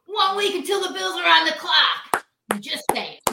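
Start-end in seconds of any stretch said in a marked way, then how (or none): 0.1-2.2 booming radio announcer voice; Bob Bradley impression. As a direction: call it like a sports radio host. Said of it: "That actually sounded like Bob".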